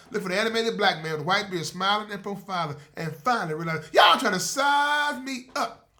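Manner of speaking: loud, high hype plug for the show; host shouting out his apps; podcast promo read. Acted. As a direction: sell the channel like a radio ad.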